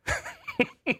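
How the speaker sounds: high wheezy laugh; laughing at a ruined dinner; real laughter. Real, not acted. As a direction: crack up helplessly at the sight. Laughs at picture of burnt buns.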